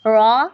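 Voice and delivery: रॉ stopped still, said full and heavy; sakin ra. Shown as a demonstration. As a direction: hold the ra of रॉ without a vowel. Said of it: "जो है वो साकिन हो जाएगा".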